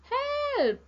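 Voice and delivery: high, loud storybook scream; very high-pitched, loud call for rescue; screamed cry for help. A narrator's reading. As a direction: shriek it out in alarm. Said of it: "screamed Rabbit and Cat".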